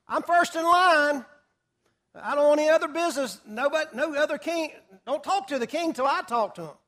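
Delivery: loud, pushy claim to be first in line; eager loud demand. Acted. Haman demanding to see the king first, loud and pushy. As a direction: push to the front, loudly insisting.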